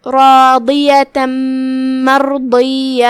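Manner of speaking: راضیۃ مرضیہ slowly, tanween merged into the م with nasal ghunna; idgham with ghunna. Shown as a demonstration. Teacher shows the merge, with a slow nasal hum. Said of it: "تنوین کو میم میں داخل کر کے غنہ کے ساتھ".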